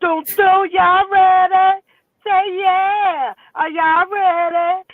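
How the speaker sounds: high, slow, stretched crowd call-out; hype host call. Pretend. Host rallying her viewers before her big gem, excited call-out. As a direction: work up the crowd like an MC.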